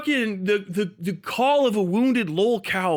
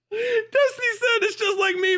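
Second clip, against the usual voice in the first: laughing; 13 semitones higher than usual. Real, not acted. Laughing at a rival's clip.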